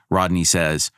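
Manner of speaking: usual speaking voice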